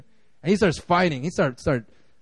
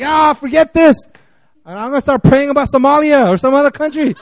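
louder, higher dismissive quitter; mock giving up; 5 semitones higher, 4 dB louder than usual. Mocking a church that quits praying, loud dismissive tone. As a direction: toss it off with a laugh. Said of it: "did we just say".

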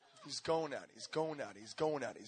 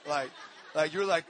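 ordinary talk, nothing marked

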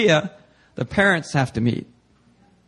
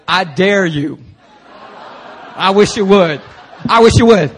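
louder, menacing challenge; threatening dare; 2 semitones higher, 7 dB louder than usual. Retold or imitated. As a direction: throw down a dare. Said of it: "in Korean, it's like".

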